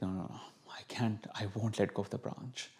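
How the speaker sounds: breathy, frantic whispered refusal; frightened whisper. Retold or imitated. A teacher whispers his refusal to his inner voice in fear. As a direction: hush it out in panic, refusing.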